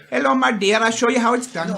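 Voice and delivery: a hint of a kindly bossy grandmother; elderly Indian woman voice. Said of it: "no voice".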